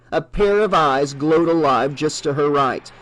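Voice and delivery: ordinary delivery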